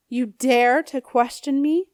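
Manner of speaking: affronted ghost rising up; indignant anger. A narrator's reading.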